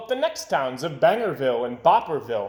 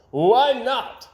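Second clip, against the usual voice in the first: loud, high agreeing; a hearty agreement; constable's voice; 9 semitones higher, 8 dB louder than usual. A narrator's reading. Town constable agreeing to the plan, loud. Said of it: "said the town constable".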